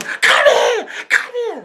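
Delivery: very high mock fight yell; shrieked challenge. Woman calling out her rival, shrieked challenge. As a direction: screech it as if squaring up.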